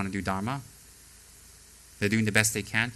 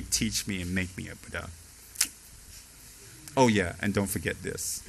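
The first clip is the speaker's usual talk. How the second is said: lower, soft and smug, a student who thinks he's doing you a favor; arrogant student; 4 semitones lower, 4 dB quieter than usual. Retold or imitated.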